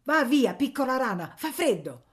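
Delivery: high, loud, easing at the end; shooing. A narrator's reading. Woman shooing a boy from the window, loud. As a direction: chase off a little pest.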